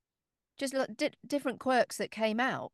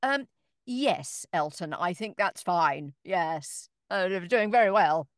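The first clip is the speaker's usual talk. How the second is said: louder, slow reassuring reply; 7 dB louder, slower than usual. Retold or imitated. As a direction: soothe a nervous star. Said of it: "I'm like".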